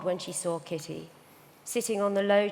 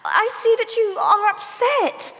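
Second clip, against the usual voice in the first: upset pleading; 13 semitones higher, 8 dB louder than usual. Acted. Sister pleading amid a quarrel.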